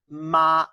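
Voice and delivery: Ma held high and level, loud; first tone Ma. Shown as a demonstration. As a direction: sing Ma on one steady high note. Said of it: "with a high level tone".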